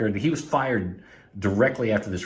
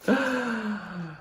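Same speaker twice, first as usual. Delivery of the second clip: excited gasp; 12 semitones higher, 4 dB quieter than usual. Real, not acted. Unboxing reveals a rare record.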